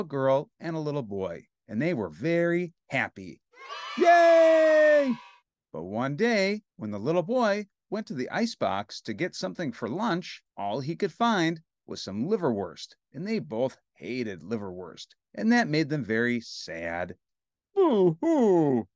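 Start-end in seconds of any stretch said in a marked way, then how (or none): 4.0-5.1 high, loud drawn-out cheer; happy shout. Pretend. Story turns happy, shouted cheer. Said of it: "and shout". 17.7-18.9 high, loud pretend cry; hammed-up boo-hoo, wailed; mock sad wail. Story turns sad, mock wailing. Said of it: "show the sad side and say".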